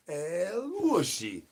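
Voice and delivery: very slow and high, each syllable stretched long; drawn-out El Wushy call. Pretend. Streamer performing the El Wushy call chat asked for, slow and high. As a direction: croon the name out slowly like a summons.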